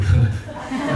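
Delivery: short airy laugh at his own joke; breathy laugh. Real, not acted. Pastor laughing at his joke about fleeing, breathy laugh. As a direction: let a breathy laugh slip out.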